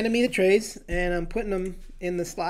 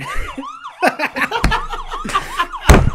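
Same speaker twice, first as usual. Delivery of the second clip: squealing high laugh; high laughing fit; 9 dB louder than usual. Real, not acted. Guest makes sound effects, high laughing fit. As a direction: burst out in a high wheezing laugh.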